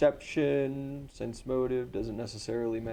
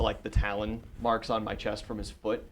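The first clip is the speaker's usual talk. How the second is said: ordinary talk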